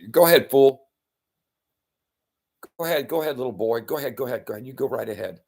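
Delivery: put-on jeering giant taunting the boy, first line loud; mocking taunt. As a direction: sneer it down at the little boy. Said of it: "probably mockingly".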